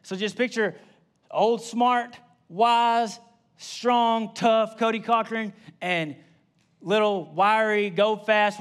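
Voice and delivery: usual speaking voice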